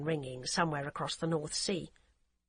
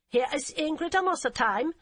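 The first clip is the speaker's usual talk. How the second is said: put-on heavy German accent; 8 semitones higher, 4 dB louder than usual. Hamburg policewoman answering the phone in a curt, heavy German accent. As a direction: clip it like a stiff German officer. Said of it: "with an accent so heavy".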